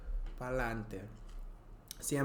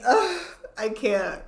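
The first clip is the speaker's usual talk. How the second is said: overcome; 10 semitones higher, 11 dB louder, slower than usual. Real, not acted. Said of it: "I feel drunk".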